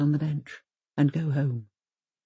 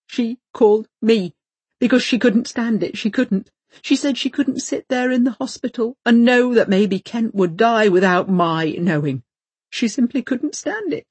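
distraught; 6 semitones higher, 6 dB louder than usual. A narrator's reading. Distraught retelling of the affair.

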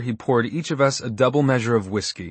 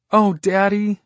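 tearful; 11 semitones higher, 6 dB louder, slower than usual. Acted. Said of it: "her face blotched pink from crying".

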